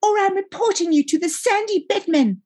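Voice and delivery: very high, squeaky demand; piping kid brother's protest; wheezing little brother. A narrator's reading. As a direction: squeal it high and squeezed, wanting it back. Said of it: "wheezed Laz".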